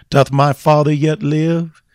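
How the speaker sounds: high, loud ringing question to the church; preached cry. Acted. Preacher crying the question to his congregation, high and loud. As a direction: cry it out as the sermon's refrain.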